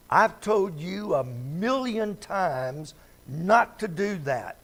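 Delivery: loud, slow exasperated scold; scolding parent. Retold or imitated. Parent scolding a disobedient child, loud and slow. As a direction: scold the child you've warned over and over.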